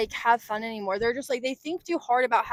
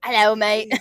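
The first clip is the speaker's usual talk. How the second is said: cheeky British greeting, a bit louder; British accent; 2 semitones lower, 5 dB louder than usual. Acted. Friend playing British movie star, mock British accent. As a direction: put on a jokey British hello. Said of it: "you're a British movie star".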